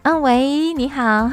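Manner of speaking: high, polite phone greeting; sweet phone voice. Retold or imitated. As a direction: switch to your nicest voice. Angry at home, sweet on phone.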